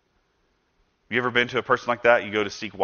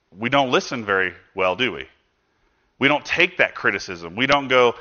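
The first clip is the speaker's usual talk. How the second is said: normal talking voice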